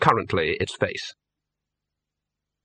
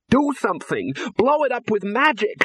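high, frantic shout; 11 semitones higher than usual. A narrator's reading. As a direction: yell at the wizards in panic. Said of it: "shouted Ginger".

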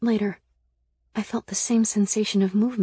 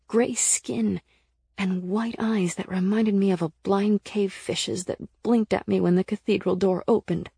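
ordinary delivery, nothing marked

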